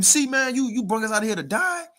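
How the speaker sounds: high-pitched accusing question; mock complaining to Moses. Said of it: "you coming at Moses saying".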